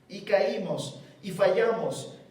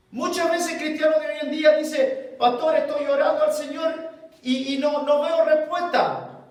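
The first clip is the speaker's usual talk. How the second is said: loud, higher preaching; full-force preaching; 5 semitones higher, 4 dB louder than usual. Acted. Pastor rebuking the congregation, loud preaching. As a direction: preach it hard to the church.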